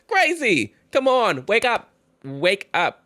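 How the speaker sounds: high, loud urging, slowing down; exasperated. Real, not acted. Creator urges the writer to wake up, high and loud. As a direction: plead with her to wake up.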